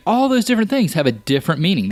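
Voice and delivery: ordinary talk, nothing marked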